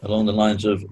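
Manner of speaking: ordinary delivery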